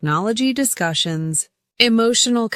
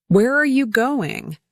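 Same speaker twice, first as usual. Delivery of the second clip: "Where" pitched up, falling away on "going"; rise then fall; 5 semitones higher than usual. Shown as a demonstration. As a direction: lift "Where", let "going" drop. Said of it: "Rise on where and fall on going".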